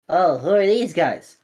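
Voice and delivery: put-on curious kid. Child speaks.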